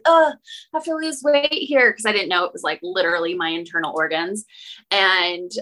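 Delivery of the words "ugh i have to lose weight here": higher, disgusted "ugh" at her own body; disgusted groan. Retold or imitated. Woman quoting her younger self, disgusted at her belly. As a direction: sneer at yourself in the mirror.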